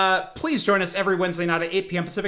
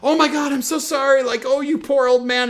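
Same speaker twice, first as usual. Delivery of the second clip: high, loud mock sympathy; exaggerated sympathetic fussing; gushing pity; 7 semitones higher, 5 dB louder than usual. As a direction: fuss over him in a higher, louder voice. Characters fawn over sick Picard.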